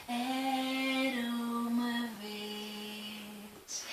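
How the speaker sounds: very slow, soft, dreamy; drawn-out soft once upon a time; slow echoey. Acted. Story opening effect. (muito lento e suave)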